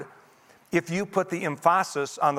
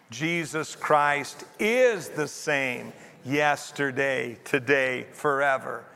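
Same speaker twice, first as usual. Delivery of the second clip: measured group reading, "is" drawn out and stressed; slower than usual. Shown as a demonstration. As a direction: lean hard on the word "is". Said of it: "put the emphasis on a different word".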